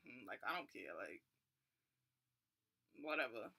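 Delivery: unhelpful agent dismissing the traveller, bored; uncaring attitude. Retold or imitated. Rude agent's uncaring face.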